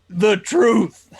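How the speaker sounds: high mocking shout. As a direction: shout the phrase back in mockery. GM repeats mocking catchphrase.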